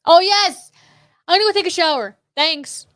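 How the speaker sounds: hammed-up loud declaration; acted announcement. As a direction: announce it big, like setting up a joke. Acting out dad's shower joke.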